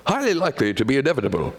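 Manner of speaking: put-on quick London accent, fast; lightly voiced London accent. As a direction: rattle it off like a Londoner. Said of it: "And if from London".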